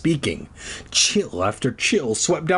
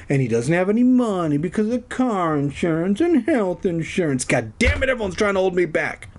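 ranting; 6 semitones higher, faster than usual. Pretend. Ranting aside about money troubles.

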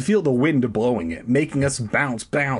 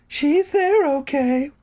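put-on very high, slow girly call; high announcing voice; 3 dB louder, slower than usual. [name]'s high voice.

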